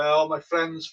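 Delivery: normal talking voice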